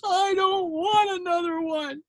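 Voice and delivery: put-on high, crying little girl's protest; hammed-up sobbing refusal; mock tearful girl. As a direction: wail it like a child on the floor. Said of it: "she falls on the floor".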